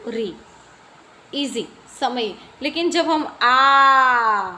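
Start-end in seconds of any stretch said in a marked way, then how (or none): 2.6-4.5 आ held out long and slow; long aa demo. Shown as a demonstration. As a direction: stretch the आ to twice the length. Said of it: "उससे दुगुना समय हमें आ बोलते वक्त लगता". (आ को लंबा खींचकर बोलना)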